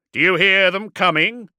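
high, loud taunting call; goading. A narrator's reading. Warlord taunts the dreadnought about approaching orcs, goading. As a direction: needle him across the battlefield. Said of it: "goaded Calcator".

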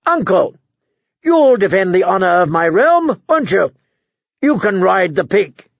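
high, mocking invitation; spiteful royal taunt; gleeful young king. A narrator's reading. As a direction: goad your uncle in a high, gloating voice. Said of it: "With a gleeful smile".

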